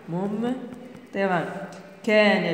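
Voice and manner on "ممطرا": "ممطرا" softly, broken after "مم"; slow, hushed dictation of "ممطرا". Shown as a demonstration. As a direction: quietly repeat "ممطرا" in separate syllables. Teacher repeats dictation softly.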